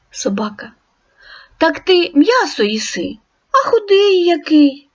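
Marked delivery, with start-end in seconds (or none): 1.6-4.9 friendly dog, voiced higher; storybook dog greeting the wolf; dog character. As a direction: ask like a curious friendly dog.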